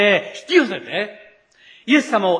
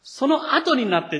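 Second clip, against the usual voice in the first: ordinary talk, nothing marked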